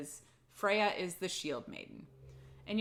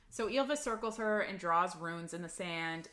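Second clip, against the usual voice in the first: usual speaking voice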